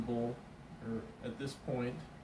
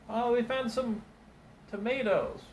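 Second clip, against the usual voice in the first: higher, louder, eager helper announcing tomatoes; excited camp helper; 9 semitones higher, 5 dB louder than usual. Acted. Desert helper calls out to the cook, higher and louder. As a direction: hail the cook with the find. Voicing camp cooks in story.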